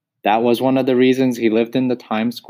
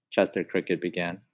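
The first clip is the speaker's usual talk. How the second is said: ordinary delivery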